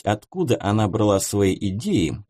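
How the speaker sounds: usual speaking voice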